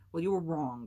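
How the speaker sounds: loud, sharp retort; snapping. A narrator's reading. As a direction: snap back with a burst of volume. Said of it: "Graham snapped".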